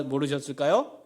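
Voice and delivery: ordinary talk, nothing marked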